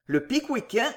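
usual speaking voice

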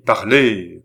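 loud, insistent command; plus impératif. A narrator's reading. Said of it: "d'un ton de plus en plus impératif". (ordre impérieux, voix forte)

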